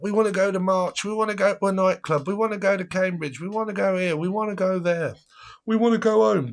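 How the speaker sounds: put-on high, insistent drunk chorus; mock screaming demands. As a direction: pile on the whiny demands. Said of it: "screaming in my ear hole".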